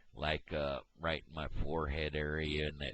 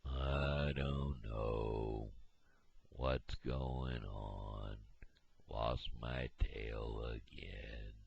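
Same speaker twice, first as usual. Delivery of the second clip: slow mopey character voice; 7 semitones lower, 6 dB quieter, much slower than usual. Acted. Unnamed character voice, slow low.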